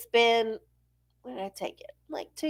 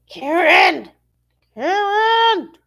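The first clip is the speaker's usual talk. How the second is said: louder, higher call; calling Karen; 8 semitones higher, 7 dB louder, slower than usual. Pretend. Summoning Karen the eight ball loudly. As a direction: call her name sharply.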